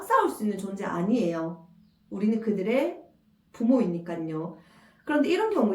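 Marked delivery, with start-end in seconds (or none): none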